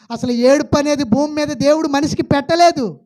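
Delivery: loud, high preaching voice, pushing each phrase out; full-force preaching. Acted. Preacher booming at the congregation in full preaching force. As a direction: preach it hard to the pews.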